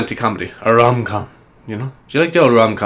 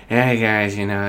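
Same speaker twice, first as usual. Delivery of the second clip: Adam Sandler impression; 4 dB quieter, slower than usual. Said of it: "That was my attempt at an impression".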